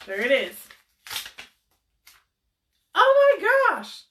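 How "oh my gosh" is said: high, slow, loud squeal of delight; drawn-out delighted cry; excited squeal. Real, not acted. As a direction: shriek with joy at the winner. Giveaway winner announced excitedly.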